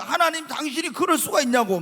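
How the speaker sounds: loud, high, indignant railing at God; angry protest to God. Retold or imitated. Man shaking his fist at God, yelling in protest. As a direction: shout the complaint up at heaven.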